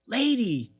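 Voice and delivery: high-pitched crowd call; retold audience heckle. Crowd to drunk woman at the show, high mock call. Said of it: "people were going".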